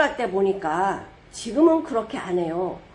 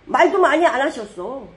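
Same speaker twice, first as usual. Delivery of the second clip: ordinary delivery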